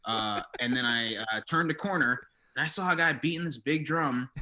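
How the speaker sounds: ordinary talk, nothing marked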